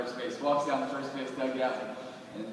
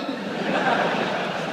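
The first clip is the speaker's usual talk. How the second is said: laugh; 10 semitones higher, 6 dB louder than usual. Real, not acted. Laughing at own story.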